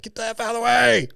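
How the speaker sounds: high-pitched mock shout; Phil's mock yell. Host imagines Phil yelling at a lapper, mock yell. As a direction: holler it in a put-on voice. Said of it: "Phil will be like".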